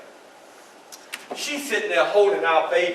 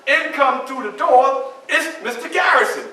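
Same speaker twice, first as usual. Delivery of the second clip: ordinary talk, nothing marked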